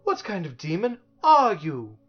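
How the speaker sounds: indignant challenge. A narrator's reading. Milo indignantly challenges the creature.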